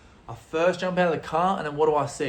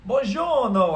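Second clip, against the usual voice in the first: high, loud, hammed-up Italian; showy Italian greeting; 9 semitones higher, 7 dB louder, slower than usual. Greeting an older Italian dude in the hostel, showy Italian accent. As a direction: belt out a mock-cultured Italian hello. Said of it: "'cause I'm a cultured guy".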